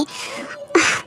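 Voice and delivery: a sharp, soft gasp of distress; high soft gasp. Acted. Wife gasping softly at her husband's insistence. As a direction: let out a small pained gasp.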